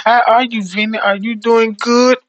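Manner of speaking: high, loud, sugary check-in; sweet hostess. Retold or imitated. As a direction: coo over the caller. Hostess chats with caller.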